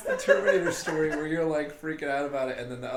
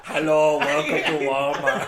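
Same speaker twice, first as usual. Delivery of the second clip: Arnold impression; 7 dB louder, much slower than usual. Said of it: "just Arnold".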